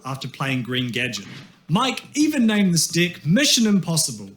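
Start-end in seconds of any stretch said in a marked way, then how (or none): none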